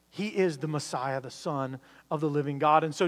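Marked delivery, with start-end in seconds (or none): none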